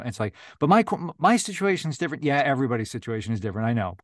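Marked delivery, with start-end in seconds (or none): 0.6-2.2 high, quick whiny protest; imitating an insistent newbie, high and fast; mock whiny excuse. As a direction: whine it up like a special case. Mocking a newcomer's excuse.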